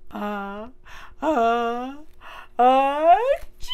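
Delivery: rising build-up into a sneeze; about to sneeze. Acted. Ant sneezes.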